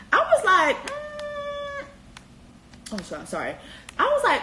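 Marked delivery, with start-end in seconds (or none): 0.9-1.8 high skeptical hum through closed lips; a raised-eyebrow high mm; doubtful hum. Retold or imitated. Vlogger replaying her reaction, skeptical high hum.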